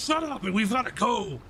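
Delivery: high, impatient put-on voice; Karzak. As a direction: order the squabbling crew to move. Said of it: "and then Karzak goes".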